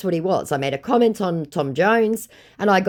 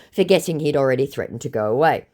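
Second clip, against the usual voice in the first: ordinary talk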